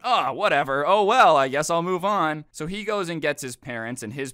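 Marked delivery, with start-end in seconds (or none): 0.0-2.4 mock shrug. Mocking driver who shrugs it off.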